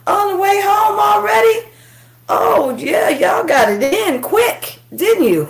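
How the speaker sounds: high, amazed, teasing surprise; surprised. Real, not acted. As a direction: act amazed he's back already. Surprised at Dad returning early.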